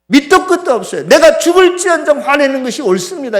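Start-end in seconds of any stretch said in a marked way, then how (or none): 1.1-3.4 loud, high defiant retort. Acted. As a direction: talk back angrily, insisting you are right. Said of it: "요나가 대드는 거하고 비슷해요". (대들듯 높고 큰 소리로)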